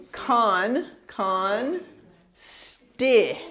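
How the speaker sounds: Con, con, sti said in slow separate syllables. Shown as a demonstration. Teacher sounding out Constitution slowly for students. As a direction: break it into sounds. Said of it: "You have to do two, two sounds".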